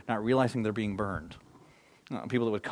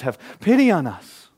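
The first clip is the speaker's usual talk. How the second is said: loud, raised plea for pity; crying out; 4 semitones higher, 11 dB louder than usual. Retold or imitated. Leper crying out to Jesus, loud raised plea. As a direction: cry out to Jesus from a distance. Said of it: "they're crying out to Jesus".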